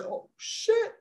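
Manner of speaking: high, breathy "Oh, shit" in sudden panic; panicked squeak. Retold or imitated. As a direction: squeak it out as if caught off guard. Panicking as director appears.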